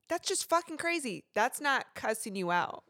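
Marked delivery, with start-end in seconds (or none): 0.1-1.2 casual cursing. Retold or imitated. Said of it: "when I'm like".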